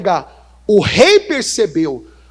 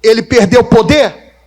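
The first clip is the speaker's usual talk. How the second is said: rousing call to the church; 3 semitones higher, 6 dB louder than usual. Real, not acted. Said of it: "Fala bem alto".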